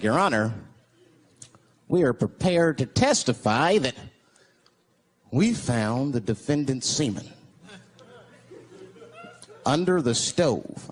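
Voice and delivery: forensics expert testifying. Retold or imitated. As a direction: drag it out like a grave courtroom expert. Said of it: "Forensics was like".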